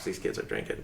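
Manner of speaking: soft, hushed alarm; whisper. Retold or imitated. Teacher whispering alarm to herself at the party. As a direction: whisper it in panic.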